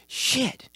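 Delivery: shit swung up high but kept soft; a hushed, high "shit" from a quiet guy. Retold or imitated. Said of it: "in this, like, hushed tone".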